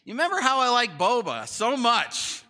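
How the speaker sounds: loud, high imitation of an eager kid; kid angling for a reward. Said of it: "My kids are like".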